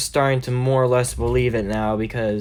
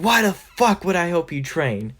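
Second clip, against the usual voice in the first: higher, mock-indignant question; irritated refusal; 6 semitones higher than usual. Bakugo is asked to help train, irritated higher refusal. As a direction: act put out, pitched up.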